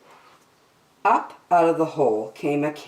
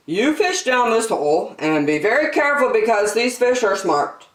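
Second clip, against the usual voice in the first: higher, emphatic storybook dad; cautioning father; 7 semitones higher, 3 dB louder than usual. Acted. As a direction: warn a child in earnest. Father warns girl in story.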